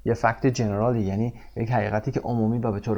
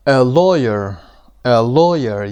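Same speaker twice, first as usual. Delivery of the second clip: loud "lawyer" with LAW punched; stress on first syllable; 8 dB louder, much slower than usual. Shown as a demonstration. Teacher models "lawyer" for students, LAW stressed loudly. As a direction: hit LAW hard in "lawyer". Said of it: "قسمت اول".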